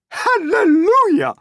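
very high, loud whoop; jubilant shout. A narrator's reading. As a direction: shriek it out in joy. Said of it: "Aunt Spiker shouted".

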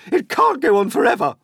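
fast, high, loud outburst; yell. A narrator's reading. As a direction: yell it out in a rush. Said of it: "Aunt Spiker yelled".

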